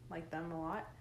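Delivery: usual speaking voice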